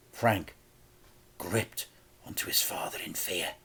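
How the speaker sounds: ordinary delivery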